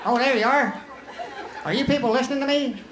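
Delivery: high-pitched, comic politician impression; Ross Perot. Politician lecturing an audience that only half listens, high-pitched impression. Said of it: "You think I look like Ross Perot?".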